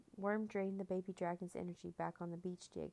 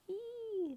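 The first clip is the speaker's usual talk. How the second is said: put-on high-pitched baby dragon squeal; long, high "ee" squeal, falling at the end; confused creature cry. A narrator's reading. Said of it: "cried out in confusion".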